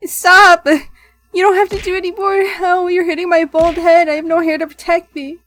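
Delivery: loud, high mock protest; yelling. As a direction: shout it in fake distress. Pelted, yelling.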